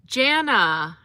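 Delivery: "Jana" drawn out slowly, short a as in "cat"; careful name pronunciation. Shown as a demonstration. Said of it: "It's more like".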